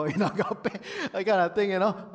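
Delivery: words broken by real laughter; laughing through words. Real, not acted. Preacher joking about the word agape, laughing. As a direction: laugh your way through the joke.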